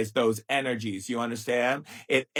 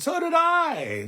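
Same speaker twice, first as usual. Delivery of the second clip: much higher, slow, stretched-out "So did I"; high emphatic retort; slower than usual. Real, not acted. Answering the horrible-childhood complaint, a high slow retort. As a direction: fire it back, slow and high.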